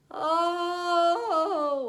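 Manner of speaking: high sung moan of grief; mournful moan. A narrator's reading. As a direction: moan it out, long and sad. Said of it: "the same mournful moan".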